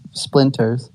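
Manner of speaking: usual speaking voice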